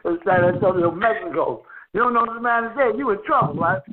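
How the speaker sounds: ordinary delivery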